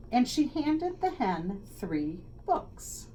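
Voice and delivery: ordinary delivery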